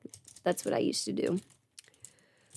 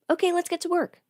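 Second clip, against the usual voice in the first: coworkers' voice; 9 semitones higher, faster than usual. Retold or imitated. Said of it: "everyone's like".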